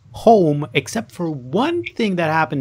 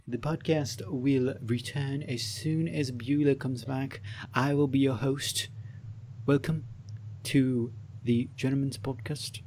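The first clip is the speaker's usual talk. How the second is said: mock host announcement; 9 dB quieter than usual. Guest filling in as host while she steps away, soft mock announcer. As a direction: play the stand-in host while she's gone.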